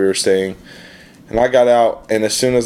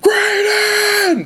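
high urgent yell of a name; shouting a name. Retold or imitated. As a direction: call the name out in panic. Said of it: "He's like".

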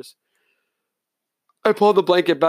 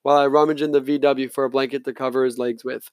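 usual speaking voice

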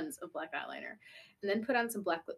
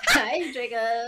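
loud, slow greeting breaking into laughter; laughing mock misery; 3 semitones higher, 10 dB louder, much slower than usual. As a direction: wail it while cracking up. Hamming up depressed greeting, laughing.

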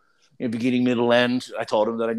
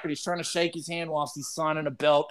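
ordinary talk

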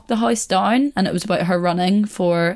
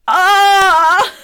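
high, loud "Ahh!"; crowd's roar of reaction; 13 semitones higher, 8 dB louder than usual. Retold or imitated. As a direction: imitate a whole chamber erupting at a put-down. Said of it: "And everyone's like".

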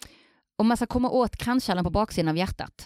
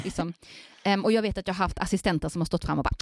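ordinary talk

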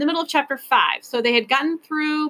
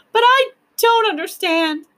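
put-on high, loud childlike wail; 11 semitones higher, 6 dB louder than usual. A narrator's reading. Lindy protesting to Ben, wailing. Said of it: "she wailed".

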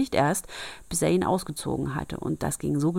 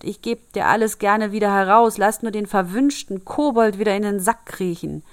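pleading, cowed; 3 semitones higher, 6 dB louder than usual. Acted. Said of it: "rief der Wirt ganz kleinlaut".

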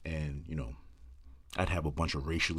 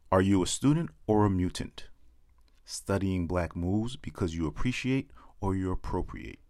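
punchy spoken-word poem, lines thrown out loud; comic slam poem read to the crowd; poem performance; 5 dB louder than usual. Acted. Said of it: "I call it mutants".

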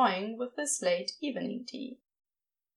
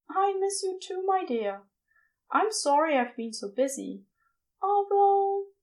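tender Aziraphale; 8 semitones higher than usual. A narrator's reading.

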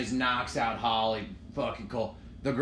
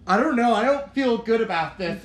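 high, loud worried whine; worried girl voice; 8 semitones higher, 8 dB louder than usual. Retold or imitated. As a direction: whine like an anxious fan. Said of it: "they're like".

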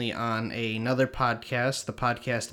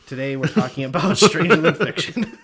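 louder, laughing through the intro; 4 semitones higher, 8 dB louder than usual. Real, not acted. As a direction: crack up while announcing the movie. Re-announcing the topic, joking.

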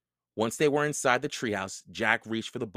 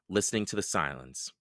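ordinary delivery, nothing marked

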